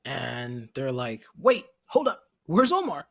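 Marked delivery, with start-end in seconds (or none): 1.4-3.1 high, louder startled question; suddenly notice the son is missing; sudden alarm. Retold or imitated. Voicing the father looking for his son.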